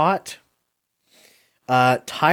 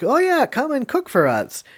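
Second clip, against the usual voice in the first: mock eager diner; 11 semitones higher than usual. Mocking the diners' invitation.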